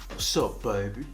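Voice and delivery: low, soft come-on to his wife; a lightly voiced smooth seducer; seductive greeting. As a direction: purr it low like a smooth talker. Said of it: "I say".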